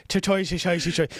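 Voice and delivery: high, stumbling go at Irish; badly faked Irish. Host showing he can't do it, hopeless Irish. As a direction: fumble for an Irish accent. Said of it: "I can't do an Irish accent".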